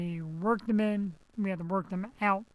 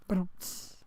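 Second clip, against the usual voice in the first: mouth rimshot; 4 dB quieter than usual. Pretend. Rimshot after a joke.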